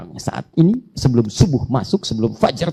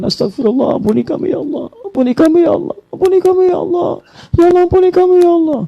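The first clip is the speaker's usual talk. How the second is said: rising louder and higher plea; family crying out; 5 dB louder than usual. Pretend. Whole family begging God for forgiveness, building to a cry. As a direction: build the cry for mercy higher each time. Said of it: "Terus semuanya mengatakan".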